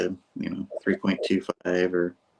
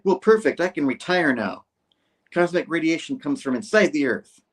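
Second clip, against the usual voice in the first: higher, exasperated sarcasm; mock sarcastic; 6 semitones higher than usual. Doctor mocking the cameraman's theory, pitched-up sarcasm. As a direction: toss it off as acted scornful agreement.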